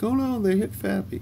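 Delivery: high mock cry of dismay. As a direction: pitch way up to mock the onlookers. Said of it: "And they're like".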